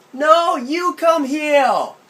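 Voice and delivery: put-on high, loud childish "Come heel!" with r turned to l; whiny six-year-old calling out; a child in a tree demanding his aunt come; little kid shouting. Child insists.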